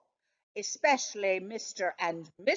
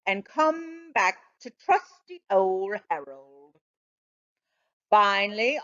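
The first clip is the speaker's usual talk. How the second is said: usual speaking voice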